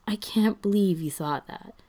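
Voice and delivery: plain reading voice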